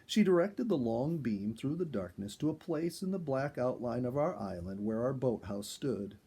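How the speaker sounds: plain reading voice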